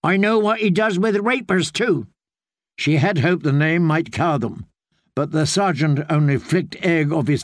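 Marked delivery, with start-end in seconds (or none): 0.0-2.1 Brienne's pointed retort. Acted. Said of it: "Brian said".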